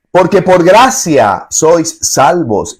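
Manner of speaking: slow, high, stressing "gracia"; solemn scripture reading. Shown as a demonstration. Preacher reading the key verse slowly to the congregation. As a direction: let "gracia" ring out slowly. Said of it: "dice la Escritura".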